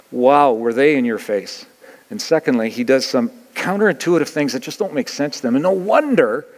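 ordinary talk

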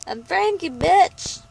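playful cat voice; voicing the cat. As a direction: put words in the cat's mouth.

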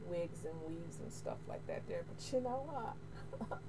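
ordinary delivery

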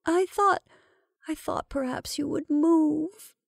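high, thin, slow faltering answer; hoarse, hesitant reply. A narrator's reading. Rebecca excusing herself to her impatient sister, hoarse. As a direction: falter upward as if the throat is tight. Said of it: "replied Rebecca hoarsely".

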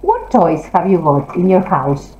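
usual speaking voice

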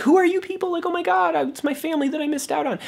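high, squealing mock amazement; a hammed-up overwhelmed reunion cry; mock shocked gush. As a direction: gush like a stunned father meeting his family. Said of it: "He's not like".

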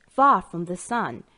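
ordinary delivery

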